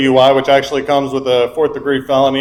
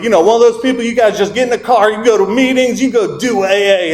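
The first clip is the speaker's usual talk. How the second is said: higher imitated southern accent; higher, hammed-up southern sponsor voice; 7 semitones higher than usual. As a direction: mimic the sponsor's southern twang, pitched up. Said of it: "a little bit of a southern accent".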